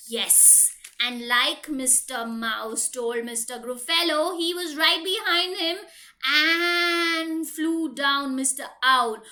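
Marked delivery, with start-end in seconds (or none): none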